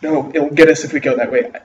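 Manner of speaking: loud, fast, desperate pleading; frantic insistence. A narrator's reading. Boy insisting to his sister they will die that way, loud and fast. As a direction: talk fast, sure of doom. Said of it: "He's like".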